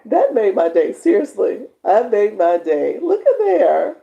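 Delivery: overjoyed gushing; thrilled. Real, not acted. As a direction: gush with real joy. Thrilled by subscriber.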